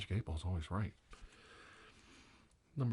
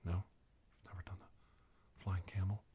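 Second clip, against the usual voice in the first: ordinary talk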